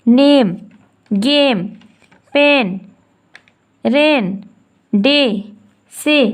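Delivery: नेम गेम पेन each read out clearly and separately; reading word list. Shown as a demonstration. Teacher reading example words aloud to students. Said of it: "ऐसे अब हम पढ़ेंगे".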